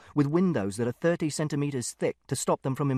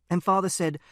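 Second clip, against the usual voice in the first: usual speaking voice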